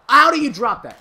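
loud, high outburst of disbelief; incredulous shout. Real, not acted. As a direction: yell it at the player who blew it. Exclaims at a dropped ball.

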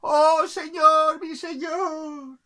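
high, loud, drawn-out acclamation; adoring subjects. Acted. People below hail the capricious king, high drawn-out acclaim. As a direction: chant it long and high as a crowd. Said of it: "mirar arriba y decir".

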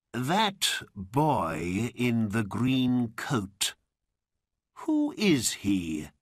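lightly voiced old man, slow and deliberate; a slow, measured inquiry; scheming old man. A narrator's reading. Old man asks an orange seller, slow probing.

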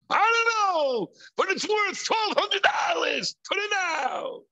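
a wedding guest justifying a purchase; impulsive buyer. Retold or imitated. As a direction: shrug it off, wanting it now.

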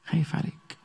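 "خايف عليك" breathed out as a soft whisper; whispered plea. Acted. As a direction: whisper "خايف عليك" tenderly, close to the ear.

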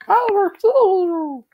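very high, loud squeaky puppet voice; high shrill puppet scream; Elmo's voice. Acted. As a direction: shriek it out like a cartoon monster.

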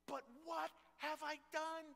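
high, whining plea; a desperate soldier begging to be let in; pleading David. Acted. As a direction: beg like a kid shut out of the game. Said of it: "he is begging to fight".

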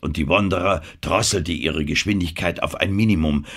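usual speaking voice

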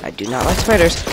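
ordinary talk

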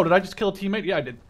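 usual speaking voice

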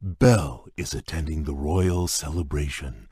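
ordinary talk

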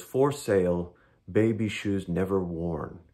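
slow, grave reading of the six words; solemn recitation. Shown as a demonstration. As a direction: recite it like a famous epitaph. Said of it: "the one that goes".

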